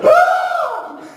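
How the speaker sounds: very high mock shriek, then a high complaint; wife shrieking at husband for the mess, very high; wife's shriek. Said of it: "she'll say".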